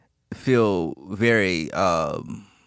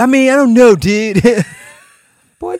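laughing; 14 semitones higher, 12 dB louder than usual. Real, not acted. Laughing at teasing.

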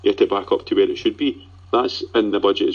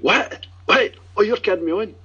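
imitating his wife's squeal of surprise, much higher; squeaky, disbelieving "what? what?" way up high; husband mimics his wife's high-pitched shock; 12 semitones higher than usual. Wife hears Clint Hill news.

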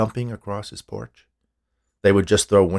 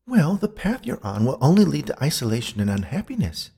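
higher-pitched woman's character voice, warning; earnest spirit, voice raised in pitch; admonishing ghost woman; 6 semitones higher than usual. A narrator's reading. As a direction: caution him as the earnest ghost.

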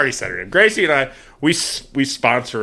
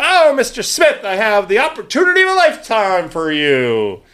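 oversell it like a cheesy telemarketer; mock sales pitch; 6 semitones higher than usual. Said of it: "And I go".

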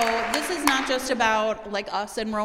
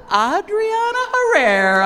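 slow, high and loud guest introduction; announcer intro; 10 semitones higher, 9 dB louder, slower than usual. Real, not acted. Host introducing author to live audience, announcer style. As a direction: announce her name like a big entrance.

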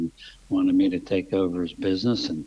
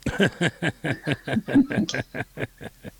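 real high laugh trailing down; hearty laugh; 10 semitones higher than usual. Real, not acted. Laughs at own racing story.

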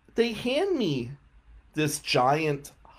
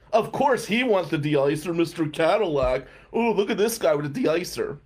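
higher, louder jeer; mocking mechanic; 5 semitones higher, 4 dB louder than usual. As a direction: imitate a heckler taunting a fancy customer. Mechanic mocks the customer.

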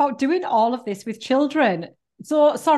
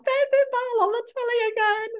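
excited exclamation; 12 semitones higher than usual. Retold or imitated. Fan spotting a friend on the telly, excited. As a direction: call it out in a much higher put-on voice. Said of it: "I'm like".

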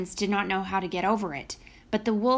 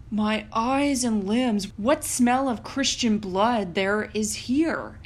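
suspicious giant. A narrator's reading. Giant sniffs out blood.

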